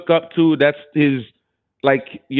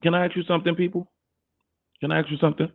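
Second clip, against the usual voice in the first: asked much softer, close in; quiet; 7 dB quieter, much faster than usual. Real, not acted. Asking his viewers a serious question, softly. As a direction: lean in and ask it softly.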